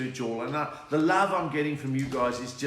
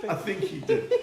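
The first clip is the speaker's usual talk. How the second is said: talking through real laughter, pitched up; laughing; 6 semitones higher, 6 dB louder than usual. Real, not acted. Comic introducing his dog Archie mid-laugh, laughing. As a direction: keep cracking up between phrases. Laughing at dog's reaction to impression.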